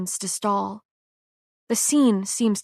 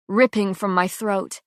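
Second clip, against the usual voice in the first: ordinary delivery, nothing marked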